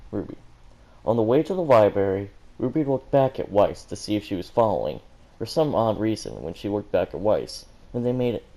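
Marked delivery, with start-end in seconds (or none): none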